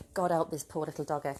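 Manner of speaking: ordinary talk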